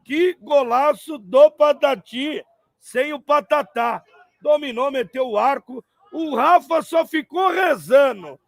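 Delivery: high, loud, thrilled play-by-play; excited commentary. Real, not acted. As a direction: still riding the goal high. Replaying the goal, still thrilled. (narração empolgada)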